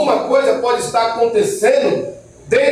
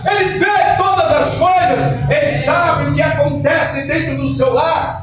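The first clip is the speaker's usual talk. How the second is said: preaching at full force; 5 semitones higher than usual. Acted. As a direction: shout the truth to the congregation. Preaching climax.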